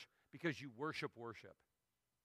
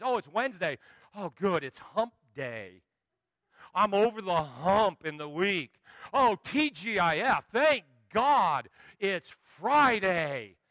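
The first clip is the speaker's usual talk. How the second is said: louder, slow, drawn-out cheer for the weekend; imitating a worker glad the week is ending, slow and loud; mock relief; 3 semitones higher, 9 dB louder, slower than usual. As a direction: ham up the Friday relief. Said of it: "I meet people that just show up".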